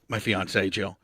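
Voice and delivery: ordinary talk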